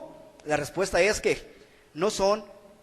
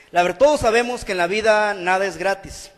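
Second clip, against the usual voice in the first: usual speaking voice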